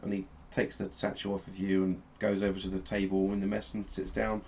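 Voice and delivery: ordinary talk, nothing marked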